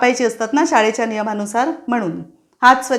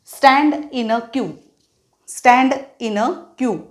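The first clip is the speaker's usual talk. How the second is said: slow teacher command; much slower than usual. Shown as a demonstration. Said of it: "असं imagine करून वाक्य लिहिलेली आहेत".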